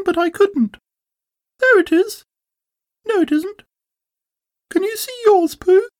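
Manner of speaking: very high-pitched little Roo voice; little Roo, eager. A narrator's reading. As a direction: chatter away as tiny excitable Roo.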